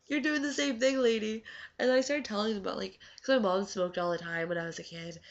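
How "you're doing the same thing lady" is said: higher, louder sarcastic comeback; sassy retort. Retold or imitated. To Sue holding a cigarette, a sassy higher comeback. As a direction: call out the hypocrisy with sass.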